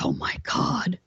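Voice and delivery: hushed awestruck oh my god; stunned awe. Retold or imitated. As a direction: gasp it out as if seeing something unreal. Staring at UFO, stunned.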